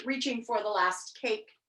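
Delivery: ordinary delivery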